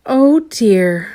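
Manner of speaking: a loud, high, far-off sigh of woe. A narrator's reading. Mister Tall sighing over his long legs, high and loud. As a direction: sigh it out like a weary giant. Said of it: "he used to sigh".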